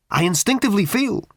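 high creature voice pressing hard; urgent creature. Acted. Creature urgently tells Ford its need, high and pressing. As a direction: urge it out, needy and high. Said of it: "said the creature urgently".

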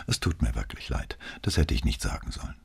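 soft, breathy, near-whispered regret; hushed apology. Acted. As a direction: drop almost to a whisper, ashamed. Repeated apology, quieter.

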